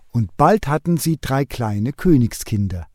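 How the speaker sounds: plain reading voice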